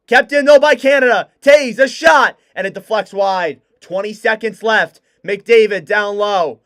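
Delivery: hockey call building to loud shouts; live play-by-play. Real, not acted. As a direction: call the rush as it happens, rising to a roar.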